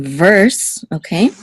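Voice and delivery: normal talking voice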